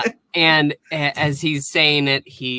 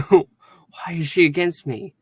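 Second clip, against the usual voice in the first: higher, halting alien puzzlement; mock confusion turning to second thoughts; imitated bewildered alien; 5 semitones higher than usual. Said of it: "And he's like".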